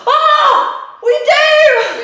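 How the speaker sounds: high, loud shout of delight; excited shout. Real, not acted. Podcaster finding fifty subscribers, shrieking with joy. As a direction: yell it out, thrilled by the news.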